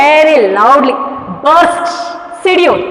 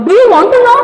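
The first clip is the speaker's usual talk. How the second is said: excited question; 8 semitones higher, 4 dB louder, much faster than usual. Retold or imitated. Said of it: "and he shouted".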